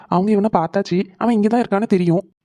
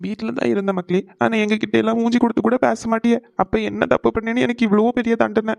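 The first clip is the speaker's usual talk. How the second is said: hurt, tearful reproach, higher voice; crying; 4 semitones higher than usual. Acted. Father asking his son why he was punished, crying. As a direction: plead through tears. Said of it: "இப்ப எதுக்கு அழுதுட்டு இருக்கீங்க".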